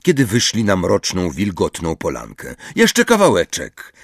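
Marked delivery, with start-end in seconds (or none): 2.8-3.9 high, lilting call. A narrator's reading. Hermione reassures Umbridge behind her, calling out. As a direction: call it out in a lifted voice. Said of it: "zawołała Hermiona".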